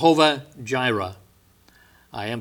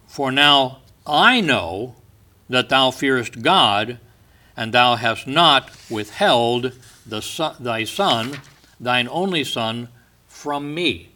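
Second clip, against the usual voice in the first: slow, weighty, raised reading of the verse; slow scripture reading; 5 dB louder, slower than usual. Acted. Preacher slowly proclaiming God's words to the congregation. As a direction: lean into each word of the verse.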